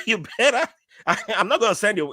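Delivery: high, giggly telling-off; laughing scolding. Retold or imitated. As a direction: scold through your laughter. Laughing while retelling scolding of nephew.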